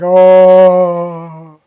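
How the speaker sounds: long, very loud "oh"; acted road-rage outburst. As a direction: blast out a drawn-out mock "oh". Road-rage scene, reaction from the back.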